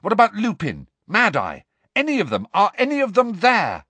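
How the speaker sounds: high, loud string of questions; urgent questioning. A narrator's reading. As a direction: press anxiously for an answer. Harry urgently questions the elf.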